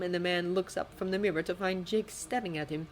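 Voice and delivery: ordinary delivery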